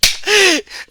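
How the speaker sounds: a sudden, high burst of laughter. Real, not acted. Laughing at his own story to viewers. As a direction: crack up at yourself.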